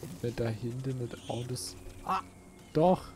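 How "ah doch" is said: normal talking voice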